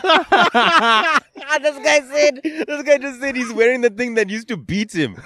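high-pitched, helpless laughing speech; cracking up laughing. Real, not acted. Host repeating guest's belt revelation, cracking up. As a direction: barely get it out through real laughter.

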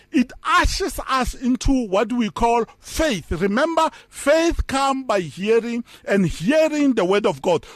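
normal talking voice

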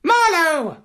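very high, squawking parrot character. A narrator's reading. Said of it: "said the parrot".

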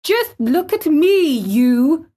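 high, defiant cries; flung out. A narrator's reading. Boy standing upright challenges the gardener, defiant. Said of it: "he flung up at Ben Weatherstaff".

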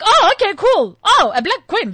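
high, loud, easygoing surprise; breezy mock reaction. Storyteller replaying her reaction to her sister's warning, breezy mock surprise. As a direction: play up a cheerful, accepting reply.

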